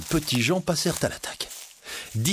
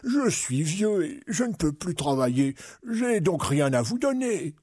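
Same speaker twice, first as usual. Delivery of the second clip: put-on high, pleading old man; imploring; 5 semitones higher than usual. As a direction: plead poverty in a frail old man's voice. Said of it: "implora Cornio".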